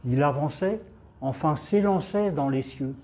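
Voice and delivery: plain reading voice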